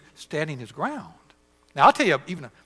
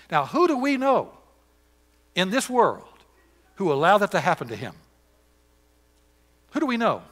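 preaching; 5 semitones higher, 4 dB louder than usual. Acted. Preacher at full force.

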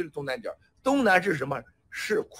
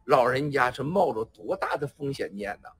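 ordinary delivery

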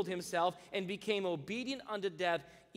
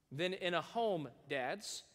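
ordinary talk, nothing marked

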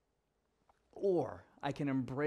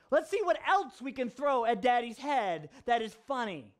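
put-on gleeful child; 7 semitones higher than usual. Voices delighted daughter's game.

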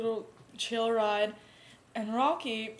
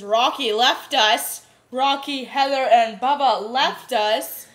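mock outrage; 8 dB louder, slower than usual. Real, not acted. Mock grievance about being left behind.

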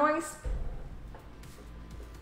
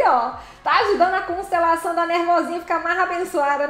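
ordinary talk

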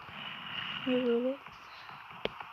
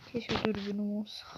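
1 whispered wish; 2 semitones lower, 6 dB quieter than usual. Real, not acted. Searching for the village.

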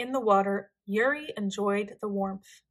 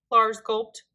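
ordinary delivery

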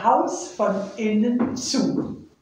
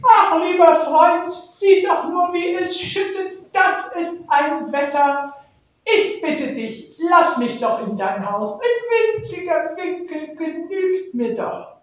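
higher, louder begging bird voice; pleading little bird; 7 semitones higher, 3 dB louder than usual. Acted. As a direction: beg your friend to let you in. Nektarvogel bettelt um Unterschlupf. (flehender kleiner Vogel)

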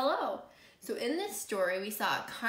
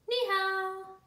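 high, held, drawn-out hello; echoed greeting; 9 semitones higher than usual. Acted. Greeting returned after the dog barks, high and held. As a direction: keep it high to the end.

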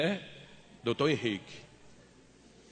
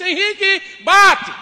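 much higher, louder sing-song call; flirty call; 13 semitones higher, 11 dB louder, much slower than usual. Retold or imitated. Passing woman to Henrique, loud teasing call.

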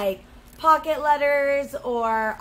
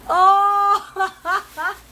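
high, loud "Oh" of delight; light up at the gift, loud and high; 7 semitones higher, 7 dB louder than usual. Real, not acted. Sees the flamingo socks.

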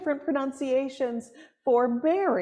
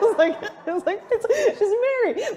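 high laughing squeals over the words; real laughter, pitch jumping high; 9 semitones higher, 3 dB louder than usual. Real, not acted. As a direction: laugh at yourself, delighted. Laughs at own accent.

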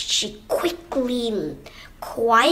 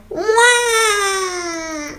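long, high pretend cry; mock baby wail; 8 semitones higher than usual. A narrator's reading. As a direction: wail like a crying toddler. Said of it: "Twin began to cry".

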